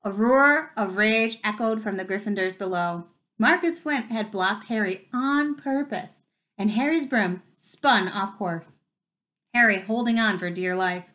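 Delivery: plain reading voice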